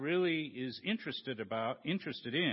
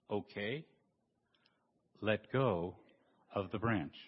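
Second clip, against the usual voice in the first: voice of God; 6 semitones lower than usual. Acted. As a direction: speak calmly from above, much lower. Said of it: "I'm God".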